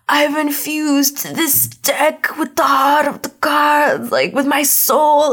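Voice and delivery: slow, high, strained grandpa imitation; hammed-up deathbed solemnity, stretched out; intense dying grandpa. Dying Grandpa handing Yugi his deck, hammed-up intensity. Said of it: "it's really intense".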